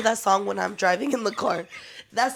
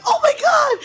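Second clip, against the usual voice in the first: high, loud panicked scream; 10 dB louder than usual. Retold or imitated. Friends in the car screaming as a truck pushes it. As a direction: shriek it as the truck hits.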